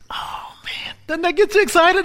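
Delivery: excited whisper. Real, not acted. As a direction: whisper swelling into a high excited cry. Whisper building to excited cry.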